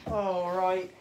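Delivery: mock-British catchphrase; amateur British accent; attempted British accent. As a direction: draw out a fake British greeting. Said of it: "that accent you're doing".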